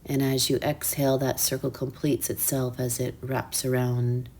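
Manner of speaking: soft, hushed meditation voice; quiet, soothing breathwork instruction with unhurried pauses; guided meditation. Real, not acted.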